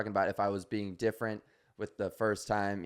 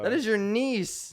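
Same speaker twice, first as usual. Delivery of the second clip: talking to a pet; 11 semitones higher, slower than usual. Real, not acted. Baby talk to the cat, again.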